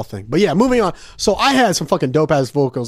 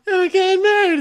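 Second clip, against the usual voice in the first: high mock voice. Groom strained smile in photo.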